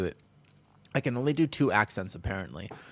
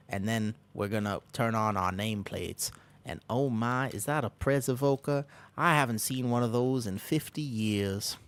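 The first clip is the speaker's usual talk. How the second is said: put-on southern accent; 3 dB quieter than usual. Country old guy narrating a dungeon run, southern accent. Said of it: "when I start speaking in a southern accent".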